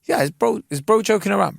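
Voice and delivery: high, disbelieving inner question; high incredulous "is bro joking around"; incredulous thought. Retold or imitated. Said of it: "And I'm thinking".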